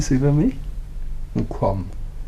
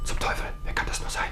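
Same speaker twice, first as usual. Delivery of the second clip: fast hushed curse; startled whisper; 9 dB quieter, faster than usual. Acted. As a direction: hiss it under your breath. Doorbell surprises husband. (erschrockenes Flüstern)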